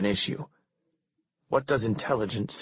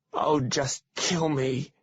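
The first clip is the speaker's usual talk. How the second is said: slow, higher wail; 6 semitones higher, much slower than usual. A narrator's reading. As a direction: plead to die in a drawn-out, higher wail. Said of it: "he wails".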